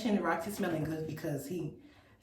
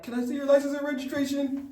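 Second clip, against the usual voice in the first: mock loud officer; 7 semitones higher, 5 dB louder than usual. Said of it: "That's how he talked. He was loud".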